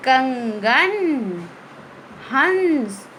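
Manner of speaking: अंगूर and अंजीर stretched slowly, the nasal ं clear through the nose; slow nasal demo. Shown as a demonstration. Teacher models words for the class, slow and nasal. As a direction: read each word slowly for the class. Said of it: "इनका प्रयोग करके हम शब्द बनाते हैं".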